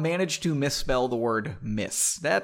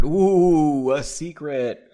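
mock intrigue; 6 dB louder, much slower than usual. Mocking reader aside.

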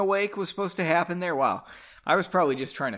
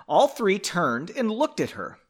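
ordinary talk

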